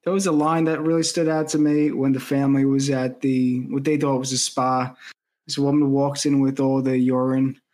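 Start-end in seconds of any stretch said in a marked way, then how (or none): none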